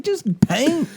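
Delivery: high mock Southern voice; Rhule, Southern accent. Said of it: "Rhule talking in a Southern accent".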